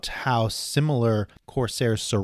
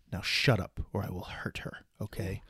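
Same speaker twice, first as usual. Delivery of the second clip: menacing whisper; 3 semitones lower, 9 dB quieter than usual. Retold or imitated. Villain threat quoted.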